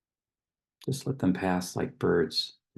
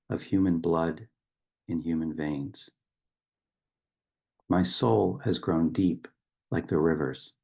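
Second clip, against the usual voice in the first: poem recitation. Acted. Reciting a river poem to open a meditation, solemn poetic delivery.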